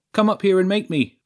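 loud, high cocky dare; booming taunt. A narrator's reading. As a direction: boom it out as if over the PA. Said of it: "his voice boomed out of the huge speakers".

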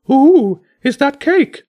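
very high, loud excited bird squawk; greedy bird voice. A narrator's reading. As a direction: squawk eagerly at the sight of cake. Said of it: "the bishop said".

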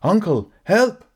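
high, loud shout for help; panicked cry for help. Acted. Collier, floundering in the pond, screams to his uncle for help. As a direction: yell from the water in panic. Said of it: "Collier called out".

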